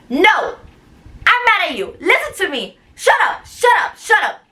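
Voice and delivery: high-pitched mock yelling, punching each phrase; mad director. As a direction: imitate the director's angry, repeated commands. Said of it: "she was like this".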